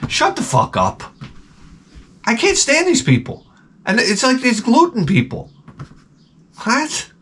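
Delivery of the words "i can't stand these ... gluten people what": exasperated rant. Real, not acted. Ranting about picky people.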